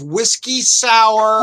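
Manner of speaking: very slow, deliberate words; said slowly. Shown as a demonstration. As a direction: spell it out slowly for him. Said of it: "let me say this slowly".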